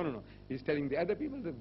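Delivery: ordinary talk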